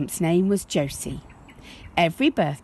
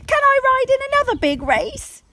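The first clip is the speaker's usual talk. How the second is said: put-on high, fast, thrilled child's question; bursting with pride on her new horse; excited little girl; 6 dB louder, faster than usual. Josie rides into parents' room, high excited child.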